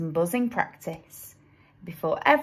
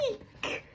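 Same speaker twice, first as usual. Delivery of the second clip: squealed eek; 12 semitones higher, 12 dB quieter than usual. A narrator's reading. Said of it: "squealed the bear".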